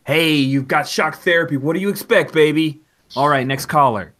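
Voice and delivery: the DJ loudly dismissing the caller and moving on; loud shock jock. Acted. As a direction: bellow it like a radio showman. DJ brushing off the caller.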